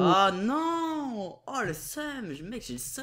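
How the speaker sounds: high, frustrated wail; panicking gamer. Real, not acted. Just died in lava.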